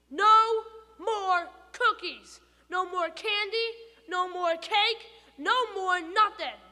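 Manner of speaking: loud, high yell, slow at first; angry shout. Acted. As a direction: shout him down. Stuart shouts at tooth.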